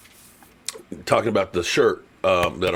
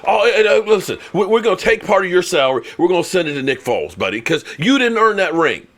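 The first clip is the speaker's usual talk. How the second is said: mock trash talk; 6 semitones higher, 3 dB louder than usual. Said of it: "where we trash Carson Wentz".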